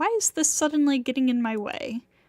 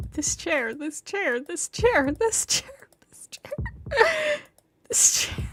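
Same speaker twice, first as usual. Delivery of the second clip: obsessive rant; 6 semitones higher than usual. A narrator's reading.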